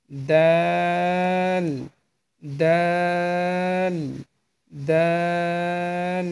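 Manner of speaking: "দাল" very drawn out, tongue tip at front-tooth roots; tongue-tip articulation. Shown as a demonstration. Teacher demonstrates the letter to learners, "দাল" dental and long. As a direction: tap tongue tip behind the front teeth, then hold "দাল" steadily. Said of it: "দুই দাঁতের গোড়ার সঙ্গে আপনার জিহ্বার আগা".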